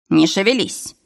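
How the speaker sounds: usual speaking voice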